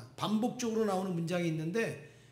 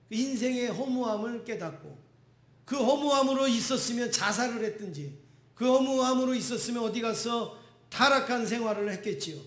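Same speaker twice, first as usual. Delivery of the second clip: fervent preaching; 4 semitones higher, 4 dB louder than usual. Acted. Pastor preaching at full force.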